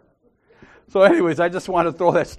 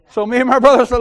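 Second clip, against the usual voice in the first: ordinary talk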